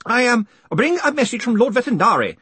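high, loud call from the crowd; shouted. A narrator's reading. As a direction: call out the message, a little hesitant. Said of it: "the man shouted".

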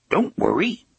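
high little gruff train voice; small gruff voice. A narrator's reading. Hidden train reassures the engineer, small gruff voice. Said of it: "said a small, gruff voice".

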